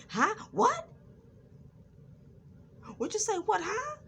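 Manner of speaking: loud, very high demonstration of rude questioning; nasty. Shown as a demonstration. Showing how not to answer someone who stutters, nasty. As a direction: show how not to ask, pitch jumping up. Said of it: "never say in a nasty way".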